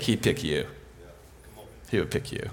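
very soft, low, near whisper; hushed reassurance. Real, not acted. Intimate reassurance to the audience.

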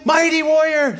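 high, loud proclamation; insistent calling. Acted. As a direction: call the doubter by a heroic title. Jesus repeating the name insistently.

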